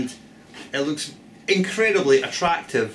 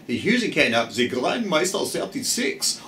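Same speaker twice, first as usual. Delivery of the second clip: mock German accent, proud announcement. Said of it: "I just have to say the next bit in a German accent".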